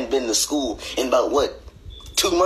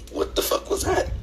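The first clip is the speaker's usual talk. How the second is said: high whisper; 3 dB quieter than usual. Acted. Shocked reaction.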